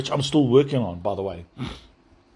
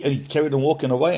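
normal talking voice

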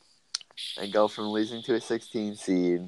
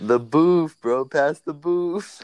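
a drawn-out, slightly higher friend voice; quoting a friend; 8 semitones higher, 5 dB louder, much slower than usual. Retold or imitated. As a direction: stretch it out as a friend's catchphrase. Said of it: "In the words of Garrett".

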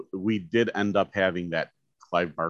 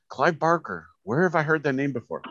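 nephew's voice; 7 semitones higher than usual. Retold or imitated. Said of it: "He goes".

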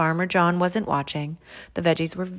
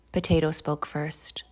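ordinary delivery, nothing marked

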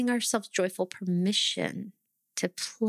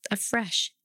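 normal talking voice

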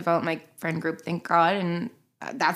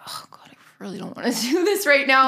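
whispered reluctance; 5 semitones higher than usual. Retold or imitated. Reluctant friend's inner voice.

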